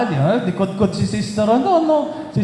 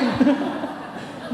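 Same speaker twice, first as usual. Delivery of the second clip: breathy delighted laugh; 5 semitones higher, 6 dB quieter than usual. Real, not acted. Delighted laugh after the quote.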